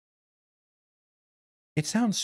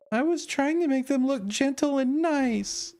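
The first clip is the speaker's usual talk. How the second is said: Mirabelle voice; 10 semitones higher than usual. Acted. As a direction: voice the shy character up high. Streamer voices Mirabelle character.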